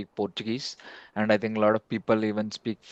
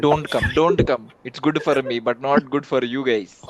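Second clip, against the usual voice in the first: raised, louder teasing imitation; mock warning; 4 semitones higher, 6 dB louder than usual. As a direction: lightly imitate a friend warning you off. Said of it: "now he's like".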